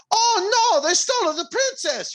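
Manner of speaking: high Italian Mario voice; squeaky Italian plumber. Retold or imitated. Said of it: "That's not what Mario sounds like".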